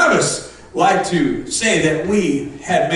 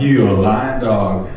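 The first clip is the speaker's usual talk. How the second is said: laughing; 9 semitones lower than usual. Retold or imitated. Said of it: "I'm like".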